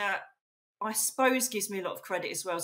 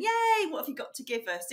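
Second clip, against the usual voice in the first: higher-pitched mock cheer; eager crowd; 7 semitones higher than usual. Medium imitating the keen Florida conference crowd, mildly excited. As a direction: lightly imitate an open, welcoming audience. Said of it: "they're all open and".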